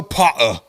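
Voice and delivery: "Potter" with a glottal catch; British dropped T. Shown as a demonstration. Asking its spelling, "Potter" without its T. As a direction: swallow the T in "Potter". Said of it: "they don't say Potter".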